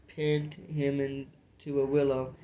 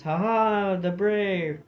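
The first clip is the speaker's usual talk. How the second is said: echoed war cry; 5 semitones higher, 4 dB louder, slower than usual. A narrator's reading. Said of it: "The wild war cry of the border and Highlanders".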